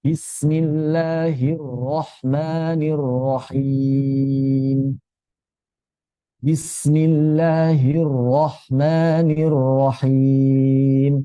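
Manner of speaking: Bismillahirrahmanirrahim chanted slowly in tajwid style; slow Quran recitation. Shown as a demonstration. Teacher reciting the basmalah so students copy it. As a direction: recite it slowly and evenly, as in prayer. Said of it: "ro tidak memantul ya".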